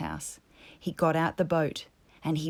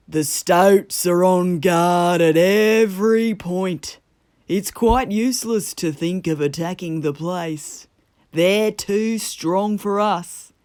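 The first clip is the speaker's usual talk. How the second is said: slow, louder Badger voice; solemn Badger; 9 dB louder than usual. A narrator's reading. Badger greeting Toad at the door, solemn and slow. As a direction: weigh each word heavily.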